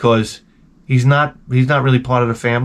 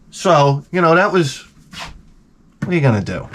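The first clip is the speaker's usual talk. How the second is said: usual speaking voice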